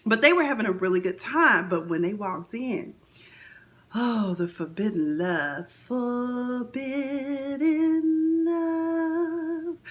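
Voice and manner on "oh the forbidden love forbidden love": slow hushed melodramatic "forbidden love"; mock dramatic. Vlogger dramatizing the two lovers meeting, hushed and slow. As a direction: drag out the words like a soap narrator.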